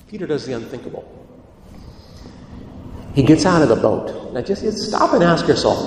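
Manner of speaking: normal talking voice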